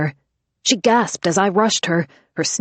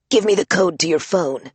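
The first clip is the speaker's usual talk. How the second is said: Batman voice. Acted. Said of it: "Vero demanded".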